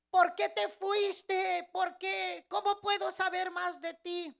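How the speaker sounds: high, loud, pleading cry; shouting. Retold or imitated. Woman asking Jesus why he left, pleading shout. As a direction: call out desperately, pitched high. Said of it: "donde yo estaba gritando".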